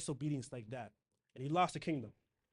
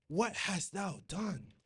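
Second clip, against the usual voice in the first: slow rebuke; much slower than usual. Acted. Samuel rebuking Saul in scripture.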